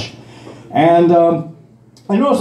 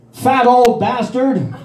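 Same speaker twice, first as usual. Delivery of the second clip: slow, higher mock yell of an insult; 8 semitones higher, much slower than usual. Driver yelling at a walker from her car window, mock yell. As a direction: drag the insult out like a passing driver. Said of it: "she yells out".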